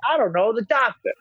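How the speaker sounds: high, muddled dad voice, laughing through it; a flustered old man fumbling his words; confused old dad. Retold or imitated. Dad confused talking to the nurse, high and flustered. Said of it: "He's like".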